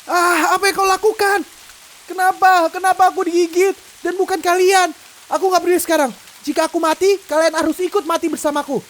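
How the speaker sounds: doomed man threatening to drag them down; desperate rage. Acted. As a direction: lash out at their luck. Bitten man turns vicious.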